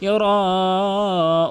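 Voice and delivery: "ইউরাউন" with a very long held "রা"; four-alif stretch. Shown as a demonstration. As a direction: chant it very slowly, sustaining the a. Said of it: "চার আলিফ করে টেনে পড়বেন". (চার আলিফ টেনে "ইউরাউন")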